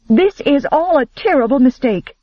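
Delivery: high, urgent outburst; bursting out. A narrator's reading. Elizabeth interrupting the men's quarrel, urgent outburst. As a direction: blurt it out in alarm. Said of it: "she burst out".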